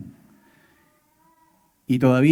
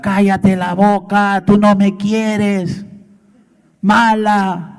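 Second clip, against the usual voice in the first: higher, drawn-out mock shouting; imitated child's tantrum yell; 4 semitones higher, 4 dB louder, slower than usual. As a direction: act out a child yelling back at mum. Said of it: "el niño le comienza a gritar".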